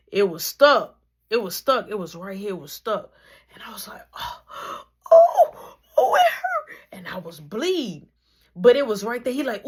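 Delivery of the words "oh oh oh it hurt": high wailing cry with a laugh in it; crying in pain. Retold or imitated. Retelling the BB gun wound, laughing wail. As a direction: wail it, half laughing at the memory.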